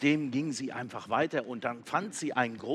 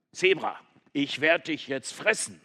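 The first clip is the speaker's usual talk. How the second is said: louder would-be lion threat; mock threat; 4 semitones higher, 7 dB louder than usual. Goat threatening the zebra, louder. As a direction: try to sound fearsome.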